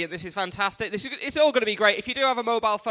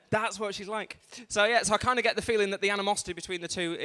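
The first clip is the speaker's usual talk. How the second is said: ordinary delivery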